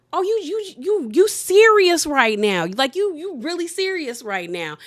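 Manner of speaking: stunned disbelief. Retold or imitated. Danielle stammering at Jennifer in high shock. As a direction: sputter like you can't believe it. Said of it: "she was like".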